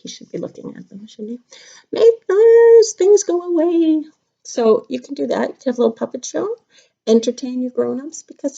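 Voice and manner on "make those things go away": high, loud squeaky fish protest; fussy fish voice. Acted. The fish objecting in the story, high squeaky voice. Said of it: "And our fish said".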